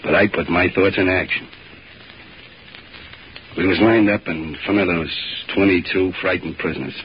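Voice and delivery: low, measured retelling of the war; story narration. Acted. Soldier recalling the gravel pit, grave narration. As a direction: narrate it as a grim memory.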